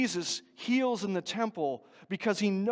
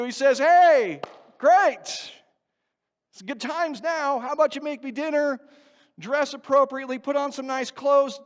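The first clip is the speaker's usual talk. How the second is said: louder, higher, driving sermon delivery; fervent preaching; 5 semitones higher, 9 dB louder than usual. Acted. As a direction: preach it with rising heat. Preaching at full force.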